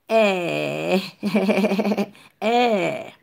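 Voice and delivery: E held long as an open è; vowel demonstration. Shown as a demonstration. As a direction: stretch E out, then pulse it. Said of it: "yon E aksan grav". (E long, tankou è aksan grav)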